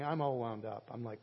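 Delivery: ordinary talk, nothing marked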